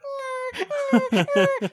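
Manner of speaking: high, far-off whimpering yelp like a scared dog; imitated dog whine. Mimicking the dog whining at a fake punch, high yelp. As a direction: whine like the pup when the fist swings. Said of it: "the dog goes".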